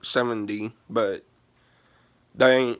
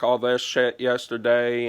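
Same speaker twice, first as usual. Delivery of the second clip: normal talking voice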